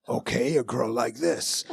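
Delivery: slow put-on voice, failing at a girl; mock actor's badly faked girl voice. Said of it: "do it like a girl".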